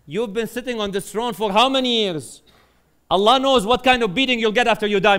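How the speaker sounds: loud, high, pressing acted admonition; stern warning. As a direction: confront a ruler with his fate. Bahlul warns the tyrant of judgment.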